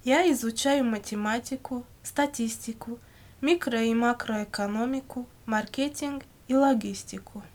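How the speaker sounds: ordinary talk, nothing marked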